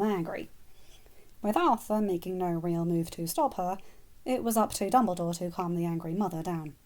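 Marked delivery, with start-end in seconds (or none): none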